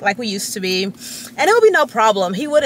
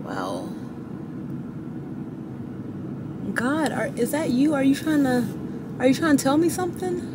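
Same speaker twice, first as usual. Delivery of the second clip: soft, awed questioning of God; hushed, overwhelmed wonder; 9 dB quieter than usual. Retold or imitated.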